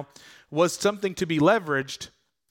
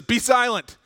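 higher, louder rebuke to the demon; stern command; 8 semitones higher, 6 dB louder than usual. Acted. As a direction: cut the demon off hard.

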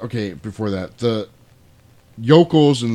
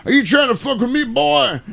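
high, loud southern challenge; hammed-up angry southern yokel; southern yokel accent; 5 dB louder than usual. Yokel challenging a dude at the bar, southern accent. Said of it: "I love doing these southern yokel accents".

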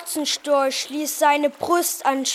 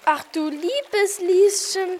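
higher-pitched, fretful lion; anxious wail; 5 semitones higher than usual. A narrator's reading. Lion cowering behind the girls at the strange bird, anxious wail. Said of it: "jammerte der Löwe ängstlich".

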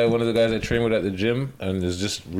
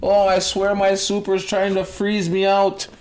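high, slow complaining woman; high-voiced mock news interviewee; lady on the news; 9 semitones higher, slower than usual. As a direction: imitate an aggrieved tenant, pitched way up. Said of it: "I seen the one lady on the news".

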